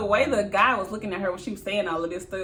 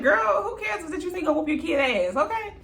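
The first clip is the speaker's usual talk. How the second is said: laughing retort; 9 semitones higher, 4 dB louder than usual. Retold or imitated. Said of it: "I'm like".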